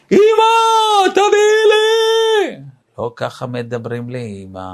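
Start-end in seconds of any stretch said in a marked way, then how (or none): 0.1-2.6 put-on child yelling. Spoiled child yelling at mother for something, much higher shout. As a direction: yell like a demanding kid. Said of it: "לא צועקים בבית".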